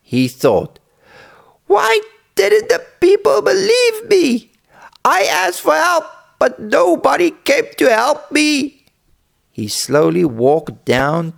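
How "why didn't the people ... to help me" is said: put-on very high, plaintive little-boy voice; acted upset boy, pitched way up; sad boy. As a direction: lament in a high child's voice. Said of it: "The boy was very sad".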